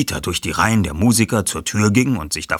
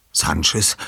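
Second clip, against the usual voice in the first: ordinary delivery, nothing marked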